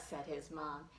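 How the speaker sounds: ordinary delivery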